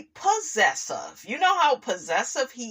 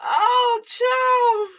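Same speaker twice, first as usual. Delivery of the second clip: tickled exclamation; 4 dB louder than usual. Real, not acted. Host still tickled by the clip, a high loud exclamation. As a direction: exclaim high after the laugh.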